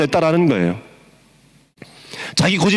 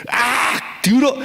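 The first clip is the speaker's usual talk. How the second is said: high, rising, irritated 들어; hammed-up angry blind man; mock angry outburst; 7 semitones higher, much slower than usual. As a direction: snap back like a man insulted. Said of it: "이렇게, 이렇게 하면서".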